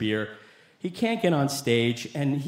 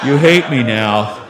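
low, slow, much louder lament; loud dejected friend; 4 semitones lower, 11 dB louder, slower than usual. Retold or imitated. Friend repeating himself after the choking, loud and dejected. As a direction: moan it loudly, low and drawn out. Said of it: "he goes".

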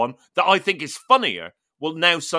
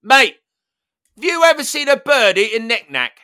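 calling out; 7 semitones higher, 8 dB louder than usual. Retold or imitated. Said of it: "He just goes".

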